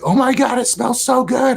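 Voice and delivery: high, gushing imitation of his mom; delighted mom. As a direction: squeal it like mom smelling the gift.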